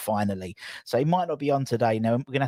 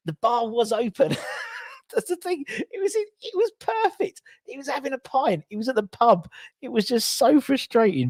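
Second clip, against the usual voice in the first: high laughing exasperation; 10 semitones higher than usual. Real, not acted. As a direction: laugh it out in disbelief. Laughing exasperation about missed interview.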